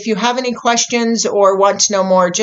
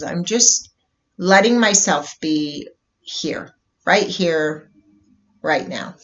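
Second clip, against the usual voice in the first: guided meditation. Real, not acted. Host leading listeners into a bubble meditation, calm guided meditation. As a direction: ease them into stillness, unhurried, letting each phrase settle.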